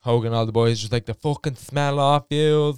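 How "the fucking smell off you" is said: put-on British girl quoted in Dublin accent; slow, loud, higher Dublin-accented complaint; mock disgust in a Dublin accent. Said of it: "I'm speaking in Dublin accent".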